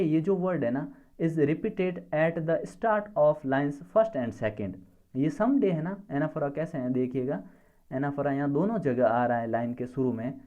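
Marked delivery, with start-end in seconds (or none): 1.2-4.8 slow dictation. Shown as a demonstration. Teacher dictating an explanation.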